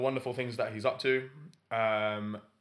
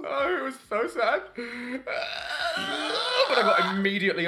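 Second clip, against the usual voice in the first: laughing; 11 semitones higher, much slower than usual. Real, not acted. Laughing about cohost's absence.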